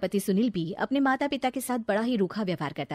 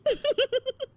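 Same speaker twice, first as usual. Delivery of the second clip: mocking laugh.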